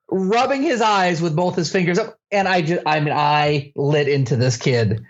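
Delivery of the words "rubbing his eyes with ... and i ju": ordinary talk, nothing marked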